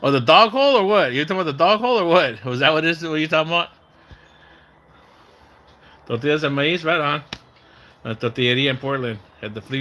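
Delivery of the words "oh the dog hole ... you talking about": higher, louder, fast what-do-you-mean; quick puzzled burst. Real, not acted. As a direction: suddenly get it and ask back. Confused by chat message.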